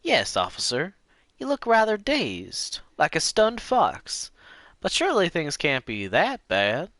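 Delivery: put-on old lady voice. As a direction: coo it like a fussing old lady. Lena voice, pitched high.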